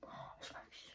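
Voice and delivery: barely audible, voiceless aside; embarrassed whisper. Real, not acted. Vlogger embarrassed at herself on camera, whispering. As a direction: whisper it to yourself, mortified.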